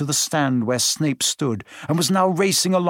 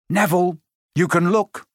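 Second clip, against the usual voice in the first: put-on boy voice; 3 semitones higher than usual. A narrator's reading. Ron reassuring Neville.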